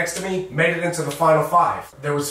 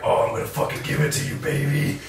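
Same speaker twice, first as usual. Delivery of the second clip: sleazy dub voice; 2 semitones lower, 3 dB quieter than usual. Retold or imitated. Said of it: "all the guys were voicing like".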